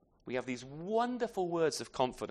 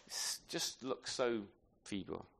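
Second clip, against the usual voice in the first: much softer, lower and slow, trailing away; hushed; 7 semitones lower, 11 dB quieter, slower than usual. Shown as a demonstration. Preacher to congregation, dropping to a soft slow hush. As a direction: let the word feeble fall to almost nothing. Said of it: "same phrase repeated in contrast".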